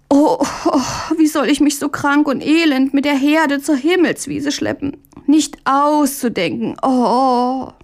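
high, crying moan, laid on thick; wailing lament. Acted. Mündlein whines to Hähnchen to skip work, high crying wail. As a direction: whimper and wail over your misery. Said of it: "Die Klagetöne des Bruders".

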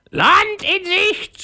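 drawn-out, very high parrot cry; shrieking parrot. A narrator's reading. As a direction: screech it out like a parrot lookout. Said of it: "schrie der Papagei". (schreiender Papagei, hoch und gedehnt)

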